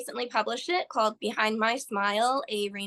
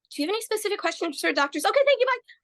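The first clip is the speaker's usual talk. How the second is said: rushed brush-off; 10 semitones higher, much faster than usual. Retold or imitated. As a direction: hurry out of the conversation like the flustered nurse. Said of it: "she, like, left a smoke trail".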